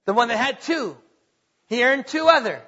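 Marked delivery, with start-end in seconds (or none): none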